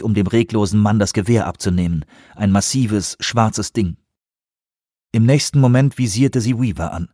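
plain reading voice

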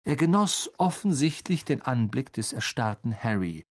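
ordinary delivery, nothing marked

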